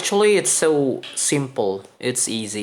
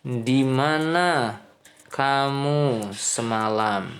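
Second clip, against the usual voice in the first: slow model sentence; slower than usual. Shown as a demonstration. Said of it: "Misalkan kamu mau bilang".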